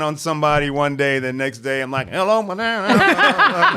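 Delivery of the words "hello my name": high, drawn-out mock stage voice; theater performer. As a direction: ham it up like a stage actor. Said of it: "we were also theater".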